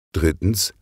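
ordinary delivery, nothing marked

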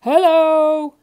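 loud, high holler; big bellowed hello; shouted greeting. A narrator's reading. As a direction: shout hello across the street. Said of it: "they shouted as they met".

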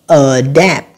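Adapt with a strong second syllable; stress on second syllable. Shown as a demonstration. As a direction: punch DAPT in Adapt. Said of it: "còn lại là âm thứ hai hết".

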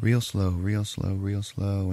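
slow, drawn-out repetition; unhurried, suspense-building crawl; real slow. Retold or imitated. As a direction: stretch each phrase to match a creeping pull. Words acted out in their pace.

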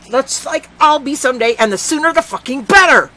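high, loud furious scream; screaming at her. A narrator's reading. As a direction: scream it all out at the girl. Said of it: "how loudly I was screaming at her".

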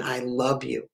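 soft, low murmured love to God; retold tearful devotion. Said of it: "crying and tears coming down her face".

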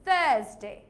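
British "thuhs-day", long vowel without R; British accent. Shown as a demonstration. Teacher contrasting the two accents, British accent. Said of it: "আর British accent it will be".

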